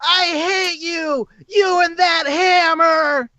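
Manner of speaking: high, drawn-out wail of a ghost; yelling ghost. Acted. Ghost child to its brother's destroyer, high drawn-out yelling. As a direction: yell it in a very high, slow voice. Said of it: "you can hear yelling at you".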